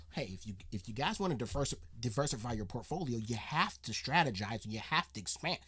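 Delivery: low, soft, fast corporate investor voice; Daymond John. Retold or imitated. Reactor mimics Daymond John's Shark Tank talk, low and polished. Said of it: "who talks like this".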